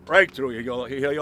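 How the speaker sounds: ordinary delivery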